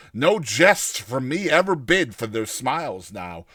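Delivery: ordinary delivery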